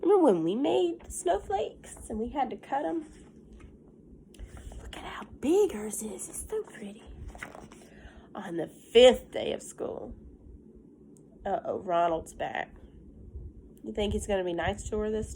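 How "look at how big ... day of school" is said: hushed whisper. Real, not acted. Teacher whispering to pupils while showing the book, hushed. Whispering to class over pictures.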